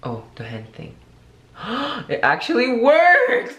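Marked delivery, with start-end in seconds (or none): 1.6-3.6 high, slow, loud gasp of delight; gasp, then cry out amazed; surprised gasp. Real, not acted. Trick with the hand works.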